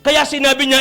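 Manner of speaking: ordinary delivery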